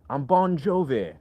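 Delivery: drawn-out, off-target American accent; failed American accent. Shown as a demonstration. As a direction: badly fake an American accent, stretching the words. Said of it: "that's not American".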